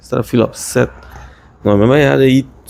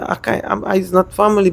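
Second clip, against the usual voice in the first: ordinary delivery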